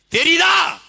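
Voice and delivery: very high, loud one-word bellow; shouted demand. Real, not acted. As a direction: blast the question at the crowd. Shouted repeat of his question. (ஆவேசமாகக் கத்திக் கேள்)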